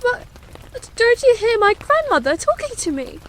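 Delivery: very high, pleading princess; almost crying. Acted. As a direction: plead high, close to tears. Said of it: "almost crying".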